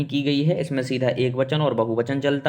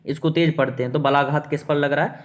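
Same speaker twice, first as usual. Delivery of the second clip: ordinary talk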